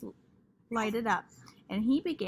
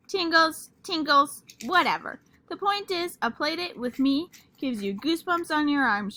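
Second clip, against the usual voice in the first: dismissive; 5 semitones higher, 6 dB louder than usual. Acted. Lucille brushing off correction.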